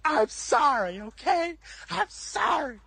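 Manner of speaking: very high, pleading wail; hammed-up tearful sorry; mock whiny apology. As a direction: imitate him begging in humiliation. Retold humiliated apology on knees.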